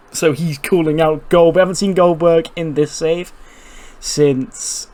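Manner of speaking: ordinary talk, nothing marked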